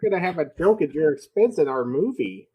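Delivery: a hint of a silly impression; George Lucas impression. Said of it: "why I keep doing this voice".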